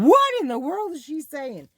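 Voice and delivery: loud, high-pitched mock disbelief; baffled viewers. Vlogger imagining her viewers' reaction, loudly baffled. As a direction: blurt it out as a bewildered audience. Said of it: "Yous are all probably going".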